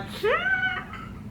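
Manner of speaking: very high, soft whimper; tiny high squeal, as if about to cry; mock-crying squeal. Reader facing the couple's angst, mock-crying squeal. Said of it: "I'm gonna cry".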